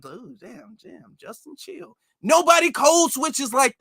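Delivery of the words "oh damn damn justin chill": hushed aside. Retold or imitated. A quiet reaction to the proper speech.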